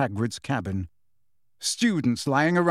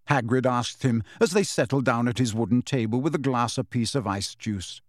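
usual speaking voice

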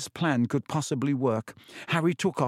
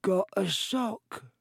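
very slow, high, hushed elf voice; elf in disbelief; 7 semitones higher, much slower than usual. Acted. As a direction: breathe it out in awe. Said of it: "said Dobby in disbelief".

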